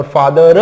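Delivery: फादर as "faa-da-ra", र hit loudly; overdone R. Shown as a demonstration. Teacher shows the other wrong extreme, overdone R. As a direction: add a full ra to the end of फादर. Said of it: "भी नहीं बोलना".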